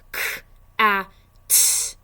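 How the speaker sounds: K-a-t said loud, each sound apart. Shown as a demonstration. Teacher sounding out cat for parents, loud and separated. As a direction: split cat into K, a, t. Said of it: "Let's sound it out".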